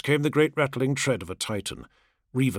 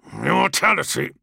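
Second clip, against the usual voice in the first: mocking bark. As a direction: spit the word back mockingly. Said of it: "Kargos barked the word, rich with mockery".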